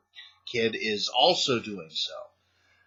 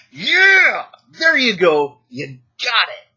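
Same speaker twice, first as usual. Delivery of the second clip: much higher, loud burst of praise; delighted cheer; 10 semitones higher, 10 dB louder, slower than usual. Retold or imitated. Teacher praising a kid's vampire answer, delighted and loud. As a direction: cheer the kid on as a proud teacher.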